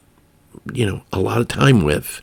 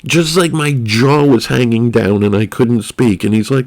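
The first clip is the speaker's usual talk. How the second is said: ordinary talk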